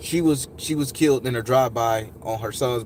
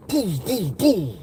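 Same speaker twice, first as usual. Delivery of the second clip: imitated explosions; 8 semitones higher, much slower than usual. Imitating bombing on the news.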